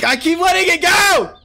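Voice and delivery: high, loud cry of frustration; exasperated yell. Real, not acted. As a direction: yell out, furious at your own slip. Keeps dropping item in game.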